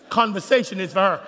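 ordinary delivery

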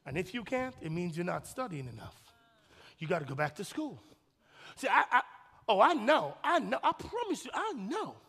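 ordinary talk

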